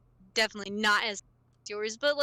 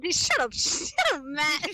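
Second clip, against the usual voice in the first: laughing shut up; 2 semitones higher than usual. Real, not acted. Teased by a friend, she laughs it off. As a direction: brush off the teasing, laughing.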